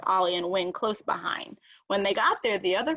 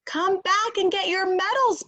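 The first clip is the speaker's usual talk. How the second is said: much higher, louder call after them; 11 semitones higher, 5 dB louder than usual. A narrator's reading. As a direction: call across the farm to runaways. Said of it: "Pete called out".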